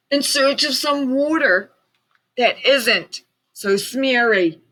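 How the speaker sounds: a small fussy creature speaking up; Lorax voice. A narrator's reading.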